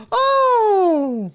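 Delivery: high, long squealed "oh"; gasp, then hold a high mock squeal; mimicked excited gasp. Crew learning he's the comedian, exaggerated excited gasp.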